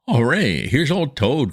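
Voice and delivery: high, loud, delighted cheer; joyful cry. A narrator's reading. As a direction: greet him with bursting joy. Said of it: "cried the Mole, his face beaming".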